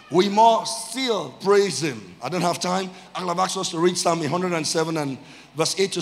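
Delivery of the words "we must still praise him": very slow, drawn-out words; slow exhortation. Real, not acted. Preacher repeating the call to praise, slow weighty exhortation. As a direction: let every word land separately.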